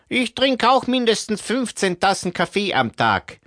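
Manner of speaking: high, thin man's voice; character voice. Acted. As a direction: play a shrugging group member. Albert speaks again.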